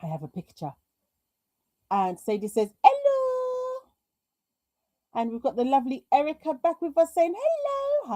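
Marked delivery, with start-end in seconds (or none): 2.8-3.8 a sing-song hello, pitched up high; high-pitched reading of hello. Retold or imitated. Reading a viewer's greeting aloud. 7.4-8.1 a sing-song hello, pitched up high; high-pitched reading of hello. Retold or imitated. Reading a viewer's greeting aloud.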